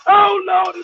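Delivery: loud, drawn-out mock plea to heaven; hammed-up holy-roller cry; mock church wailing. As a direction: wail it out in mock devotion. Mocking over-religious film characters.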